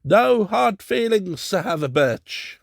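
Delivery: high, loud call. A narrator's reading. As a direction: raise the voice to reach him. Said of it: "Then raising his voice".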